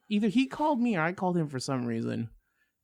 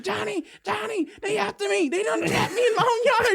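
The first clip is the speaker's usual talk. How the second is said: mock panic; 3 dB louder than usual. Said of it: "I get that call".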